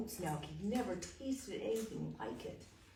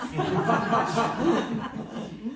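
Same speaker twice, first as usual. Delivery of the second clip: louder burst of laughter; 5 semitones higher, 14 dB louder than usual. Acted. Young man laughing loudly to the Duke. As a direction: laugh out hard.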